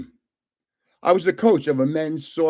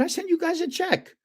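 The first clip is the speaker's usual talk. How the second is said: much higher, retold indignant protest; 13 semitones higher, 4 dB quieter than usual. To the dog warden on the phone, high indignant protest. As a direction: act wronged and baffled.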